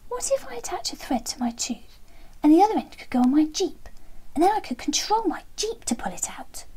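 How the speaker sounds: put-on a small child bursting with an idea; excited little boy. Little boy shares his big idea.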